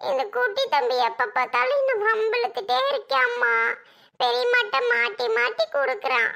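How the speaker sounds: put-on a little girl telling on her brother; young daughter complaining. Daughter complaining to her mother about her brother, whiny child voice.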